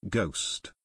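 understated scorn, low and soft; scornful. A narrator's reading. Chet replies to talk of a ghost, mildly scornful. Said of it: "Chet replied scornfully".

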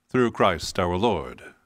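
normal talking voice

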